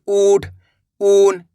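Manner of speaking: "ऊठ, ऊन" with long, drawn-out ऊ; stretched vowels. Shown as a demonstration. Teacher repeating examples for learners, "ऊठ, ऊन" drawn out. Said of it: "इस तरीके से खींच के पढ़ा जाता है".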